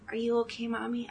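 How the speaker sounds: soft unvoiced whisper of a worried child. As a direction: breathe it to hurt mom. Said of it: "He goes".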